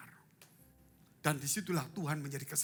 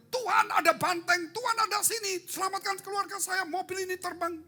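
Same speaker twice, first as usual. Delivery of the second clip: much higher, louder hurried plea; panicked prayer; 5 dB louder than usual. Retold or imitated. Driver praying before a crash, panicked high plea. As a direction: babble to God as a crash looms. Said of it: "Saudara nggak bilang".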